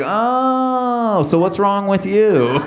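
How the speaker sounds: long, high "Oh", then slow question; dawning realisation. Acted. Bystander finally learning what service dogs are, nosily enlightened. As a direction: draw out the oh, then pry tactlessly.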